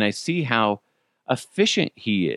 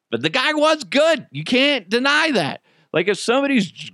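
excited; 13 semitones higher than usual. Real, not acted. Raised praise, high pitch.

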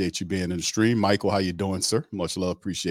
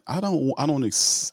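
ordinary delivery

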